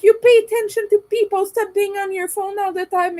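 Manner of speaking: high, loud, fast mock scolding; rude man scolding. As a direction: bark the lecture back in a mocking high voice. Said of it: "He was like".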